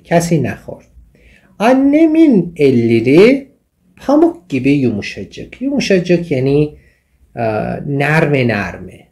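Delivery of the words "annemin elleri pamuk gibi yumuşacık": slow reading. Shown as a demonstration. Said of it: "teacher reads example sentence slowly".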